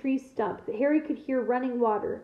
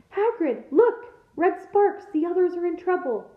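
higher, urgent alarm; alarmed cry; 6 semitones higher than usual. A narrator's reading. Hermione grabbing Hagrid's arm at red sparks, alarmed cry. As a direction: raise the alarm, pitched up.

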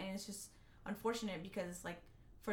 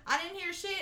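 high-pitched denial; 10 semitones higher, 5 dB louder than usual. Retold or imitated. Retelling her own denial.

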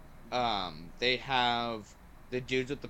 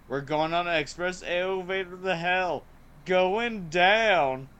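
higher and louder, a swaggering marine showing off; Hudson's cocky line; 6 semitones higher, 7 dB louder than usual. Retold or imitated. Fan quoting Hudson's favorite line to his cohost, higher, louder swagger. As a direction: ham it up like a hotshot marine before the drop. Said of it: "my favorite line from Hudson".

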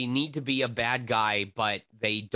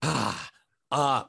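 ordinary talk, nothing marked

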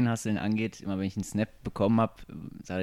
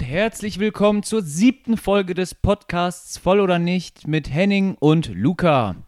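Podcast-Intro; 8 semitones higher, 10 dB louder, slower than usual. Acted. Podcast-Intro, Showname ausgerufen.

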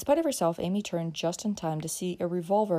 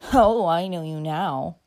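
put-on loud, slow, jeering drunk; drunken cry; 10 dB louder, slower than usual. A narrator's reading. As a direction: bellow it with slurred recognition. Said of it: "cried the other".

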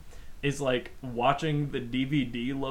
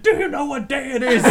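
high, playful misheard question; mock excitement; 8 semitones higher, 4 dB louder than usual. Joking that the officer had a celebration to announce, mock excited. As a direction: ask it brightly through laughter. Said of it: "I thought you said".

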